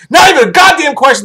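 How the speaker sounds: high, forceful verdict; emphatic insistence. Real, not acted. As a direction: shout it as beyond all doubt. Emphatic repeat of his verdict.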